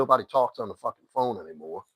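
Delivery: ordinary talk, nothing marked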